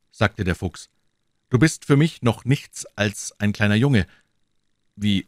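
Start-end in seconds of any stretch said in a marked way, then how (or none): none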